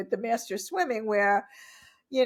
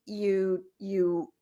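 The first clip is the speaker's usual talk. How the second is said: usual speaking voice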